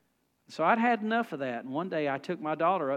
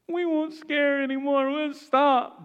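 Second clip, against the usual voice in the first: lightly voiced kids, higher and louder, begging; pleading little boys; 9 semitones higher, 5 dB louder, slower than usual. Boys negotiating with dad, mock pleading. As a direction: plead fast like a desperate little boy.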